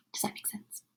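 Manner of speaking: whispered to herself. Acted. Teacher whispers while checking a word. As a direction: softly wonder whether it fits.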